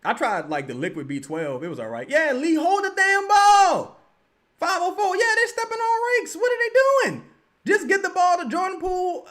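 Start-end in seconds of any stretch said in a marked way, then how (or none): 2.1-5.2 yelling at the TV. Real, not acted. Streamer yelling at the team on TV, loud and high. 5.2-9.3 high, loud exasperated shouting; frustrated yelling. Real, not acted. Streamer venting at the team on TV, loud high shout.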